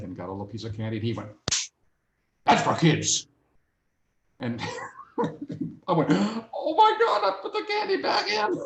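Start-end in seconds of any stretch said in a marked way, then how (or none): 2.4-3.3 loud, emphatic imitated telling-off; loud Father Christmas reprimand, acted; mock scolding. 6.1-8.6 very high, squealed mock alarm; high-pitched comic fluster; mock panic. Acts out shocked reaction to scolding.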